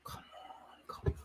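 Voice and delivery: tense breathless whisper. Real, not acted. Fan whispering nervously as the attack builds. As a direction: hush it, barely daring to hope.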